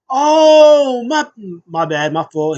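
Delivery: long high loud "oh", then a stumbling apology; startled mock apology. Diner caught in Bobby's seat, startled, apologetic. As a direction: imitate a caught-out man blurting excuses. Acting a flustered wrestler apologizing.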